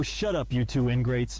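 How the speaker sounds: ordinary delivery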